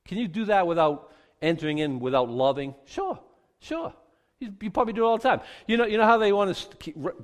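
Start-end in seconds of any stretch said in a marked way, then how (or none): 2.8-3.9 high, light, quick "sure"; offhand yes. Acted. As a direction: toss it off high and soft. Answers own question offhandedly.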